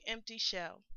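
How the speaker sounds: ordinary delivery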